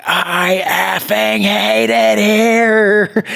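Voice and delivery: high, drawn-out mock tantrum wail; imitating a six-year-old's outburst; retold tantrum yell. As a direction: stretch every word like a kid having a fit. Retold kid's angry outburst.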